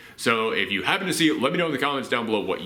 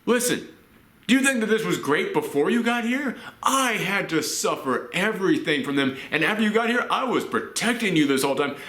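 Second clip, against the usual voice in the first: higher, impassioned retelling of the brother's outburst; a brother unloads years of hurt, higher and pleading; pleading older brother; 6 semitones higher than usual. Retold or imitated. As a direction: voice the brother laying it all out, pitched up. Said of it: "talk to his brother and go".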